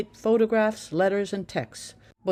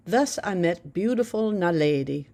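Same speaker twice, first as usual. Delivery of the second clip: ordinary delivery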